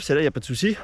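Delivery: ordinary talk, nothing marked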